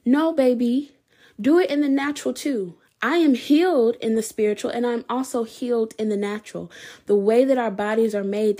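usual speaking voice

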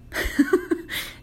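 real amused laugh. Real, not acted. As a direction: laugh at your own punchline. Laughs at own line.

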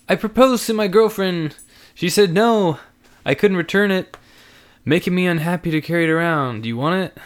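put-on eager young guy pleading; young man voice. Andrew voiced in story.

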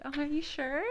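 high, coy, fishing for compliments. Pretend. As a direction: ask it needing reassurance. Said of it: "fishing for compliments".

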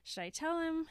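light singsong deliberation; weighing yes. Retold or imitated. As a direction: lift the voice for the yes side. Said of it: "she's been doing the, like".

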